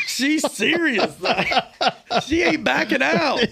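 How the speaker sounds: marveling that his bride is dead serious, laughing hard; laughing disbelief. Real, not acted. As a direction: laugh it out in amazement.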